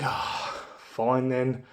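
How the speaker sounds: soft, sighing give-in; imitating a deflated, quiet surrender; resigned concession. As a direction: give in reluctantly with a breathy "oh". Giving in to pushy seller.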